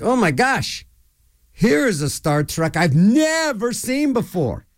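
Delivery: high, drawn-out mock amazement; retold excitement. Viewer thinking he has found an unseen Star Trek, excited. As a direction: act out thrilled disbelief, stretching the key words. Retelling his excitement at new episode.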